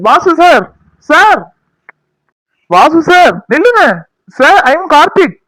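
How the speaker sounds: high, loud call to someone walking away; calling out loudly. Acted. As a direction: shout his name to stop him. Calling after Vasu to stop.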